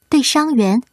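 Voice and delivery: plain reading voice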